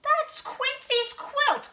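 very high, loud quacking duck voice; Dottie. A narrator's reading. Duck spotting the stolen quilt, high quacking cry. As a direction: squawk it slowly, high and loud. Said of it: "quacks Dottie".

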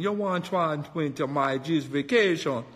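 loosely faked Jamaican accent; lightly put-on Jamaican tourist talk; half-hearted Jamaican accent; fake Jamaican. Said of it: "I started faking Jamaican".

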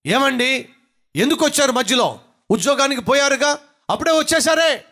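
high-pitched mock shrieking wife, quick; wife yelling. Wife scolding husband home early, high mock yell. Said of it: "అరుస్తుంది గట్టిగా".

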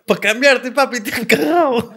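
mock through laughter; laughing mockery. Acted quote, laughing.

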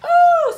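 big loud high cry of frustration; exaggerated cry. Real, not acted. Frustrated at ModCloth, a loud mock wail. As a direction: let out a hammed-up wail. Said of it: "so angry".

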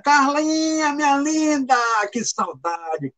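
delighted hello to someone missed; affectionate greeting. Real, not acted.

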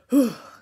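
loud, high sigh falling away; sigh with sound. Shown as a demonstration. Coach offering a noisier option, sigh with sound. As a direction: let voice ride out on the sigh. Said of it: "letting out the sound sigh".

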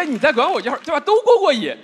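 ordinary talk, nothing marked

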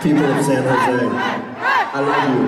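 distorted alien sound; eerie alien-like echo. Real, not acted. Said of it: "It sounds like some kind of alien".

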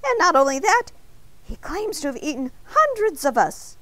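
alarmed warning. A narrator's reading. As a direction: sound the alarm, voice up and insistent. Donut warns baker in alarm.

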